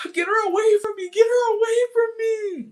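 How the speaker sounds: high, loud get-her-away cry; mock hysterical yell. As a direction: ham it up like a hurt victim. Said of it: "I'm like".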